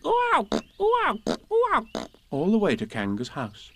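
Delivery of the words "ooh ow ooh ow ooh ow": high repeated jolted yelps; little animal bumped up and down; bounced "ooh, ow". Acted. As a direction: squeak on every bounce. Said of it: "he was saying".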